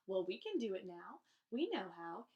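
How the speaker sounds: plain reading voice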